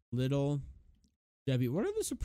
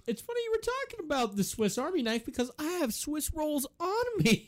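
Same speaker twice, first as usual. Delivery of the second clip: high, goofy spokesperson voice; hammed-up cheery mascot pitch; Will Ferrell impression. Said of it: "he's like".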